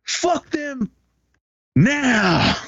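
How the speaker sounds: high, squeaky imitation of a scolding mother; a hammed-up warning, high and thin; mock threatening mom. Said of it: "she was like".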